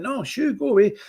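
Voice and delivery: high-pitched mock brush-off; dismissive shooing. Jail staff sending a released prisoner away, mock dismissive shooing. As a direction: shoo him away in a light, high voice.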